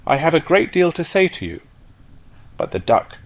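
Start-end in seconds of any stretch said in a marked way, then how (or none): 0.1-1.6 calling after. Acted. Rocket calls after duck.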